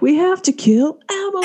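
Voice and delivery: high, hammed-up group declaration; mock dramatic realization. Two friends plotting to camera, mock dramatic. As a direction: play it big, as if to camera.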